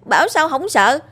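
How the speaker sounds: put-on high, protesting boy's voice; a kid defending himself to his grandfather; high indignant child talking back; arguing back. A narrator's reading. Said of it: "Tôi cãi lại".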